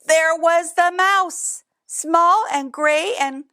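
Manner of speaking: high and slow, savoring the surprise; drawn-out high storyteller reveal. A narrator's reading. Repeated reveal of mouse.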